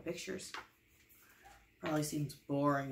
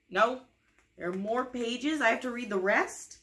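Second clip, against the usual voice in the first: mock dismay; 5 semitones higher, 7 dB louder than usual. Reader protesting more pages.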